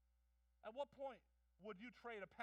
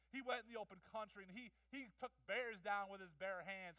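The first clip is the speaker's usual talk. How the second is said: normal talking voice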